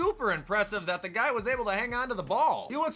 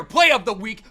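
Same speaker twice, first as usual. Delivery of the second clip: normal talking voice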